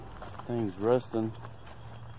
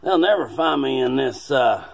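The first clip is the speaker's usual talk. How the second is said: put-on old man agent voice; 5 semitones higher, 6 dB louder than usual. Agent escaping aliens.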